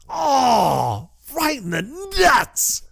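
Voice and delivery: squeaky high, slow wounded groan; hammed-up hurt squeak; pained high squeal. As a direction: voice cracking up high in mock agony. Wriggle in pain, retold.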